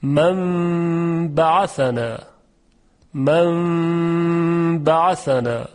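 من بعثنا chanted slowly, n turned to a held nasal m; Quranic recitation. Shown as a demonstration.

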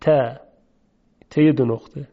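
ت said thin and light. Shown as a demonstration. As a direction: voice the plain ت delicately.

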